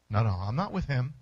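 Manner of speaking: low, hurried denial; lightly voiced frightened Peter; Peter's denial. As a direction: brush off the question, voice dropped low. Peter denies knowing Jesus.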